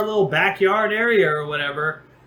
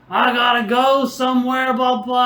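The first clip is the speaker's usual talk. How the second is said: higher-pitched mock drunk insistence; drunk friend; 7 semitones higher, 3 dB louder than usual. Drunk friend atop the fence to his host, mock insistent whine. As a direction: imitate a drunk pal insisting on leaving.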